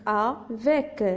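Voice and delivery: slow "a - vec" in two parts; syllable by syllable. Shown as a demonstration. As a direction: split "avec" into drawn-out syllables. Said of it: "j'ai séparé le texte en syllabes".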